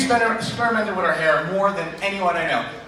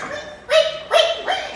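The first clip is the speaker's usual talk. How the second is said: very high squeaking reek sounds; high squeaky boot noise imitation; slower than usual. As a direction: squeak it like wet boots. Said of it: "so I heard".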